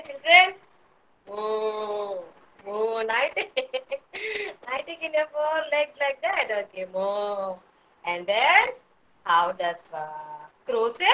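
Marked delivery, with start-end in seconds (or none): 1.3-3.0 long, low, soft moo; cow moo. Shown as a demonstration. Teacher showing children what a cow says, low soft moo. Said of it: "What does a cow say". 6.9-7.6 low, soft, drawn-out moo; cow moo. Shown as a demonstration. Teacher repeating the cow sound for children, low soft moo. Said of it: "What does a cow say".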